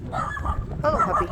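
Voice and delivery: very high cooing puppy voice; high squeaky greeting to a puppy. Real, not acted. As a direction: coo at the dog in a squeaky voice. Greeting a dog.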